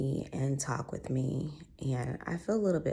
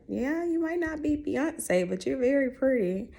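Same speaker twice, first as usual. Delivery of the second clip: put-on little boy voice; 11 semitones higher, 4 dB louder than usual. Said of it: "some little boy looks like".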